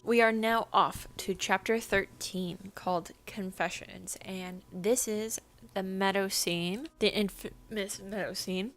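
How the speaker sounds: ordinary delivery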